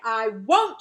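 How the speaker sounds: loud, flat-out refusal; a child's loud stubborn no. Acted. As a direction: dig your heels in loudly.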